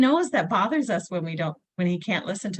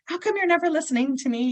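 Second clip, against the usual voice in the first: higher-pitched, exasperated mock complaint; retold mad outburst; 10 semitones higher than usual. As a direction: imitate your own fed-up parent self, voice pitched up. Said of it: "get so mad and raise my voice".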